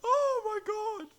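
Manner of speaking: high, slow amused groan; barely getting words out through laughter; high giggly oh my god; laughing disbelief. Real, not acted. Laughing at friend's joke.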